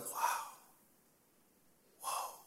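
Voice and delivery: very soft, whispered wow; hushed, with a long pause between words; imitated hushed awe. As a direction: breathe it out like an awestruck crowd gone quiet. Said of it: "everybody starts whispering to each other".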